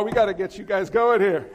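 usual speaking voice

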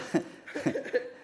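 quick high-pitched laugh. Real, not acted. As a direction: break into a sudden laugh. Laughs mid-sentence at crowd.

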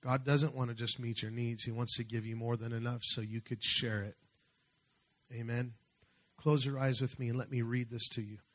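ordinary talk

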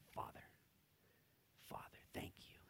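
soft whispered prayer; tender whisper. Acted. Jesus praying to Father, soft tender whisper.

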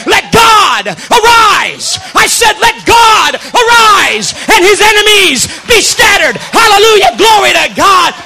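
loud, high revival shout with stretched words; full-force preaching. Real, not acted. Preacher praising God before the church, exultant shout. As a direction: proclaim it in triumph, each phrase hurled out.

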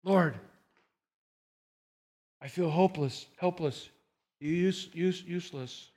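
soft, fading plea to God; quiet prayer. Shown as a demonstration. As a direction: pray it quietly, breaking off. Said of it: "and then cry out to God".

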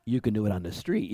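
lightly voiced hypnotized echo; soft, low, obedient mimicry; mind-tricked cop. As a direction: repeat it back low and quick, as if entranced. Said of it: "a Jedi mind trick on the guy".

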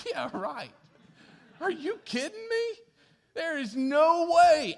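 high, loud 'are you kidding me' disbelief; incredulous scoff. Retold or imitated. Abraham scoffing at God's promise of a son, incredulous. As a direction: scoff at the promise like it's absurd.

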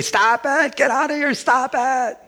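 put-on high, shrill-pitched old woman pleading "stop it"; old lady protesting. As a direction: play the frail old lady who can't bear to hear it. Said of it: "She would say".

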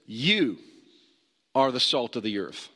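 "You" set apart by a long pause before the rest; single out "You" with a dramatic pause; stress on you. Shown as a demonstration. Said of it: "I want you to notice something here".

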